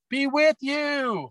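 high, drawn-out class chant; imitating a whole class shouting the answer in a high pitch; kids yelling back. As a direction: squeal it back like a room full of kids. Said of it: "and they'd yell out".

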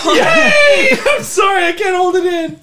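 bursting out in loud laughter; can't hold the laugh in; high, loud real laughter. Real, not acted. Player breaks up laughing.

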